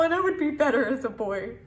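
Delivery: usual speaking voice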